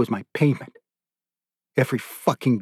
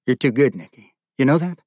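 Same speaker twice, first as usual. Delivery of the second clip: ordinary delivery, nothing marked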